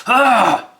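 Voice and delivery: sudden high yelp of alarm; startled cry. Acted. Frostbite startled by the wisp, a high yelp. Character reacts to Will-O-Wisp.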